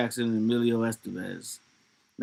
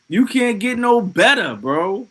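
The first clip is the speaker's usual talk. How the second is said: higher and louder, fan gushing; raving; 8 semitones higher, 6 dB louder, slower than usual. Real, not acted. Streamer gushing to chat about the sax player, high and excited. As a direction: sell it as the best ever.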